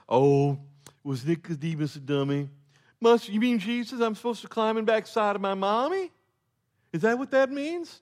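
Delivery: high, loud mock-dumb voice over the whole question; dumb hayseed mock. Preacher mocking a dumb misreading, high and loud. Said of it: "we kinda look and we go".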